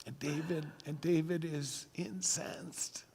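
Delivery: a hushed, breathy telling of David's rage; dramatic whisper. A narrator's reading. Preacher telling the congregation David's fury, whispered.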